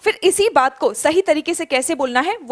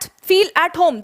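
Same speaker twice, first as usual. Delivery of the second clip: slow model answer; 3 semitones higher, much slower than usual. Shown as a demonstration. Teacher giving students the exact phrase, very slowly. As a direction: feel at home spoken very slowly and clearly. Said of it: "that's it, आगे पीछे कुछ नहीं".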